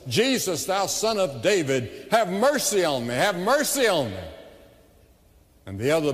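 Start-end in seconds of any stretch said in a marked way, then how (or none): 0.1-4.2 raised, high-pitched pleading cry; desperate cry. Acted. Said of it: "cried at the top of his voice".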